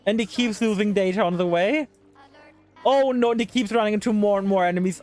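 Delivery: ordinary talk, nothing marked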